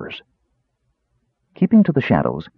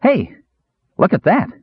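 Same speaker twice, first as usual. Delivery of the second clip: high-pitched excited exclamation; eager kender's high cry; 12 semitones higher than usual. A narrator's reading. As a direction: pipe up high, pointing something out. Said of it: "Earwig cried".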